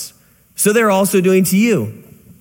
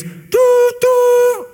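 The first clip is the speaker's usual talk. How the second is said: high sustained hooting toot of a train horn. Retold or imitated. Preacher telling a parable imitates the approaching train's horn with a high hoot. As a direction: hoot it like a distant train whistle.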